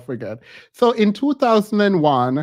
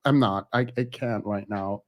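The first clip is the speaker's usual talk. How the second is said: hoarse lost voice; 8 semitones lower, 7 dB quieter than usual. Real, not acted. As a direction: voice nearly gone, hoarse. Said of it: "every Saturday I lose my voice".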